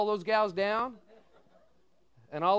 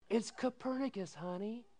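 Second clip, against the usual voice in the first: wife correcting husband softly; gentle correction; 3 semitones higher, 7 dB quieter than usual. Retold or imitated. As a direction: kindly set him straight.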